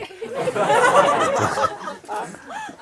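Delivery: loud high burst of laughter; high delighted laugh. Real, not acted. Teacher delighted by his friend's news, laughing high.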